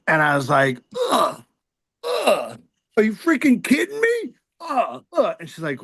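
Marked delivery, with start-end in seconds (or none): 1.1-5.4 much higher whiny groans of disbelief; exasperated groaning. Retold or imitated. Husband groaning at his wife over a Tulane-alum doctor, whiny and high. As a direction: whine like a fan told to see a rival's doctor.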